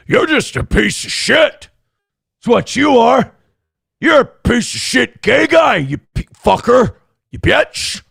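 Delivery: high, hammed-up insult tirade; imitated angry abuse. Imitating how Karmic talks to him, mock angry abuse. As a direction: spit out mock insults in a strained put-on voice. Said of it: "talk to me like that".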